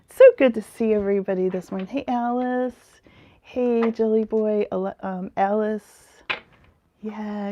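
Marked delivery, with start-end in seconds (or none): none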